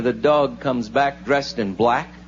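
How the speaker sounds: ordinary talk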